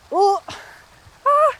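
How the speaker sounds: ooh then a high loud ah; startled wobble gasps. Real, not acted. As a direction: gasp as your balance tips. Wobbling in tree pose.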